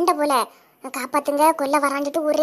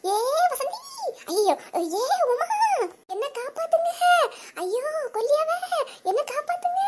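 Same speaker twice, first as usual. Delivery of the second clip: much higher, hammed-up frightened pleas; calling for help; 8 semitones higher, 4 dB quieter than usual. Wife calling friends by name for rescue, hammed-up pleas. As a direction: keep pleading, half laughing.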